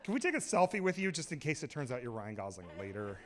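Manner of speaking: lightly mocked fan, soft and low; imitated girls' voice. Second girl doubting the star's denial, lightly mocked and hushed. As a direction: put on a hint of a pestering fan girl. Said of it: "they had that voice".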